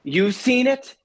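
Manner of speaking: high, loud incredulous question; mock yell of disbelief; imitated yelling. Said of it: "She's yelling at me".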